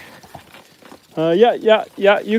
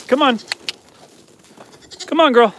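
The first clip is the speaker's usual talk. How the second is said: calling out; 8 semitones higher than usual. Real, not acted. Calling goats to come.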